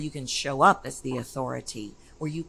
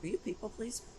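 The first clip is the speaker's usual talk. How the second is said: soft, higher-pitched gentle probe; probing question; 7 semitones higher, 10 dB quieter, much faster than usual. Retold or imitated. Coach asking a new client questions, soft probing. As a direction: ask it softly and lightly, lifted in pitch.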